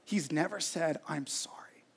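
soft retelling sinking into a whisper; tearful mother. Retold or imitated. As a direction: let mom's hurt fade to a whisper. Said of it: "with tears in her eyes".

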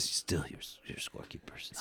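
hushed, voiceless complaint; very soft imitated aside; retold whisper. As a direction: whisper it like a private grumble to the ref. Retold muttered complaint to the ref.

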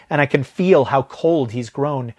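ordinary delivery, nothing marked

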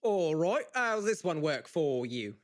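loud, drawn-out Scottish accent. Shown as a demonstration. Actor trying the audition line, loud Scottish accent. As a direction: project it in a slow Scottish accent. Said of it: "can you do Scottish?".

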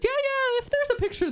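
high-pitched mock reassurance; dismissive brush-off. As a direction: fob them off in a high imitated voice. Radio staff brushing them off.

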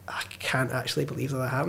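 whispered, stunned disbelief; awed whisper. Retold or imitated. Fan stunned after his photo with his hero, whispering. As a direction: breathe it out in disbelief.

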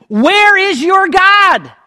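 dramatic mock taunt; taunting cry. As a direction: hurl the question out. Mockers taunt the psalmist.

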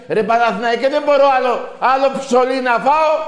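loud, high wailing complaint; mock fan outcry. Host imitating a fed-up fan crying out to the team, loud.

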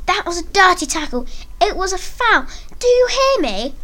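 high-pitched protest, only mildly screamed; screamed accusation. Acted. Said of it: "as he screamed at me".

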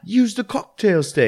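high, slow British ad voice; hammy posh commercial voice; commercial actress. Retold or imitated. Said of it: "that chick in that commercial".

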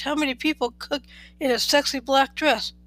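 ordinary delivery

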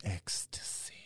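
whispered, low. Real, not acted. Echoing his friend's suggestion in a whisper. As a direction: breathe the word out.

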